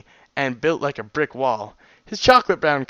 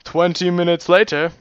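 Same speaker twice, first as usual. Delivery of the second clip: lightly put-on caption voice, louder; time-card announcer; 3 semitones higher, 5 dB louder than usual. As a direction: announce the time jump like a cartoon title card. Spoken scene-change caption in put-on voice.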